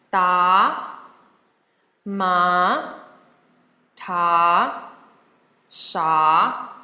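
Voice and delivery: rising second tone on "Da, ma, ta, sha". Shown as a demonstration. Teacher modelling for students to repeat, "Da, ma, ta, sha" in rising tone. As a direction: start each of "Da, ma, ta, sha" low and glide upwards. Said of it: "going upwards".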